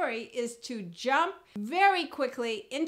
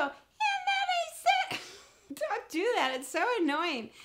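squeaky, very high mock-woman falsetto; bad woman impression; 11 semitones higher than usual. Shown as a demonstration. Guy badly acting out a woman, annoying falsetto. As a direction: overdo a silly falsetto woman. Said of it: "you're acting out a woman, don't go".

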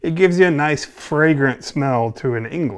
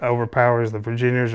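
normal talking voice

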